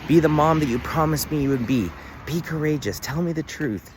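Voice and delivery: retold yell. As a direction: yell it up high like you're pushing her to be brave. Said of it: "I was yelling at her".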